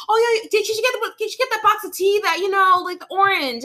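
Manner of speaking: high, bright gossiping coworkers; high-pitched coworker imitation. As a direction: chirp it like nurses in a break room. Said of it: "I'd hear them in the back room be like".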